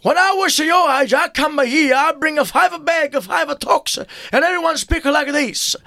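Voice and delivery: put-on high-pitched immigrant papà with thick accent; Johnny, ethnic dad. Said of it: "Johnny parla un poco". (voce acuta da papà immigrato, accento forte)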